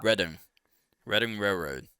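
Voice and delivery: "Reading" said RED-ing, with short e; RED-ing pronunciation. Shown as a demonstration. Confirming the railroad's pronunciation, "Reading" as RED-ing. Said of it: "it's pronounced".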